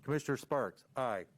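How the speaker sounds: ordinary talk, nothing marked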